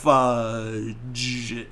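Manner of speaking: every sound stretched way out; slow-motion curse. Retold or imitated. Ralphie swears in front of dad, slow-motion. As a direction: drag the word out like a slowed-down film. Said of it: "all in slow motion".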